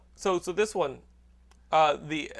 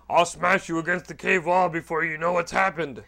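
Hulk; 3 dB louder than usual. Acted. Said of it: "Here's the Hulk first".

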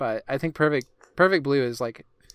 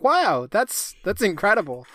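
higher, excited gasp of awe; amazed; 7 semitones higher than usual. Retold or imitated. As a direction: sound blown away by it. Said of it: "you're just like".

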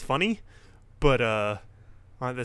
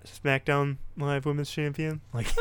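usual speaking voice

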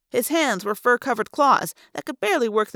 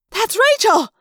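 high, loud cry of recognition; urgent high-pitched shout; yelled; 12 semitones higher, 6 dB louder than usual. A narrator's reading. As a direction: yell it out excitedly. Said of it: "Cassie yelled".

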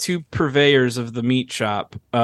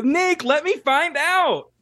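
high pleading voice; slower than usual. Acted. Jokey plea to listener.

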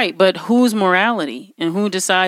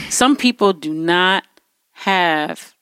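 normal talking voice

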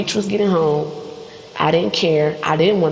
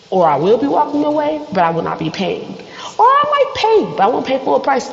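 rising demands; 8 semitones higher than usual. Acted. Escalating demands, rising pitch.

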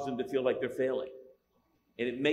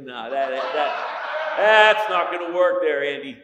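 exasperated shout; 11 dB louder, slower than usual. Retold or imitated. Objecting to Andy Garcia's accent.

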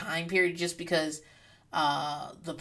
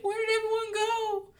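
much higher, small lost voice; plaintive high question. Pretend. Alone after sending everyone away, small lost high voice. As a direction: ask it like an abandoned child. Imagined lonely plea.

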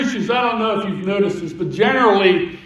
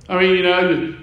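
usual speaking voice

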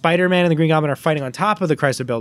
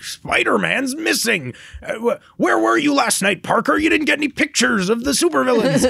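much higher, blustery boss berating his photographer; gruff newspaper editor; 10 semitones higher than usual. Retold or imitated. Editor scolding Peter Parker for missing pictures, high blustery impression. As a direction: bark at Parker like a harried editor.

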